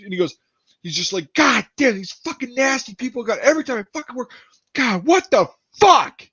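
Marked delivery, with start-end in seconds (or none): 1.3-6.2 high, cursing imitation of a fed-up man; exasperated rant. Janitor fed up with nasty people, high exasperated rant. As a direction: wail the complaint, drawing out the last curse. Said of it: "he's just going on a rant".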